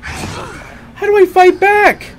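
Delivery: high, loud startled cry; startled yell. Real, not acted. Jumped by a monster in the game, startled yell. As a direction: yelp in real fright.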